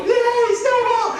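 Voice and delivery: put-on very high, loud child's squeal; shrieked kid cheer; cheering little boy. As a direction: whoop like a thrilled small child. Small son cheers over snowball.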